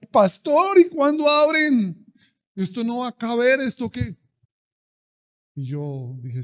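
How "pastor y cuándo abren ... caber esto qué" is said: much higher, louder desperate asking; desperate congregant. Retold or imitated. Desperate members demanding pastor reopen, loud anxious. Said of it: "la gente desesperada decía".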